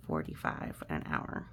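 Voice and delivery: ordinary delivery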